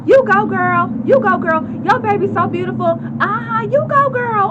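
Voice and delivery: high, loud sassy cheer; a high, loud you-go-girl cheer; cheering audience. Retold or imitated. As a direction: hype her up like a cheering fan. Audience members cheering her on.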